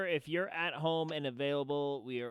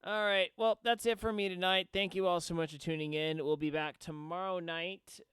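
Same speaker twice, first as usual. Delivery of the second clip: show close; 6 semitones higher, 4 dB louder than usual. Acted. Streamer signing off to viewers.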